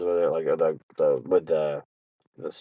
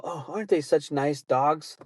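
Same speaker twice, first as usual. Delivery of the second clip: higher, slow cooing imitation of dog lovers; gushing older couple; 7 semitones higher than usual. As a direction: mimic them fawning over dogs, drawn out. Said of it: "they look at me and they go".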